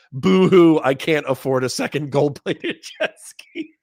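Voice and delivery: loud boo-hoo breaking into laughter; mock upset. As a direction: fake the self-pity, then crack up. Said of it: "I do think I'd be upset".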